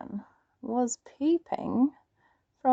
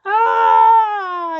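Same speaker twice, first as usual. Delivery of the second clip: loud, high startled scream; Granny's yell; 9 semitones higher, 10 dB louder than usual. Acted. Granny screams at the wolf, loud and high. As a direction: shriek in shock. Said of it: "yelled Granny".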